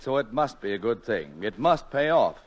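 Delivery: ordinary talk, nothing marked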